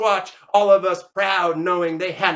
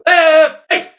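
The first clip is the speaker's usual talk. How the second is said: high, loud parade-ground call; shouted drill command; 9 semitones higher, 12 dB louder than usual. Acted. Veteran turning the squad, shouted order.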